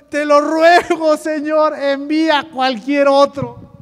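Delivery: slow, loud, imploring plea; Moisés begging. Acted. Moses begs God to send someone else, drawn-out pleading. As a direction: drag out each phrase as you beg. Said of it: "le suplica de nuevo al Señor".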